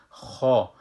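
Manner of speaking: خا said as a full heavy kha. Shown as a demonstration. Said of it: "حرف خا".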